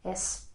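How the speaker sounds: "S" softly, with a short, narrow E. Shown as a demonstration. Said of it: "The sound E is short and narrow".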